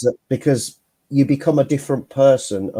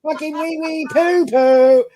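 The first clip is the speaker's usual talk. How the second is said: high, loud silly chant of poo poo; silly childish voice; 12 semitones higher, 6 dB louder, slower than usual. Retold or imitated. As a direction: squeal it like a giggling kid. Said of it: "you would just come in out the blue and go".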